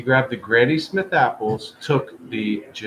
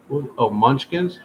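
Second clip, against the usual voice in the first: normal talking voice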